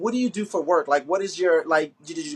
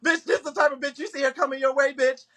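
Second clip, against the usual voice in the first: much higher, worked-up comic warning; excited joking; 9 semitones higher than usual. Real, not acted. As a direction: egg them on in a high, laughing rush of alarm. Host jokes excitedly about running away.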